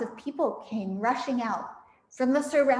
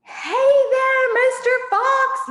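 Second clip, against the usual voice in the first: much higher, loud taunting yell; yelling Bunch; 13 semitones higher, 9 dB louder, slower than usual. Acted. Farmer yelling at Mister Fox from his tractor. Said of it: "yelled Bunch".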